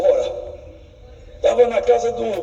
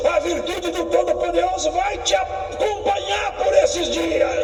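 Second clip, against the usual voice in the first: high, slow, ringing blessing over the congregation; preaching at full force; 8 semitones higher, slower than usual. Real, not acted. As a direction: proclaim it slowly to the church.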